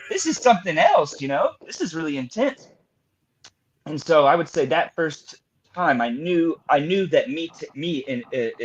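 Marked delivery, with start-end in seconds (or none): 0.1-2.7 much higher, louder incredulous outburst; retold disbelief. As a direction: blurt it out, stunned by what you see. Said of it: "And I'm like".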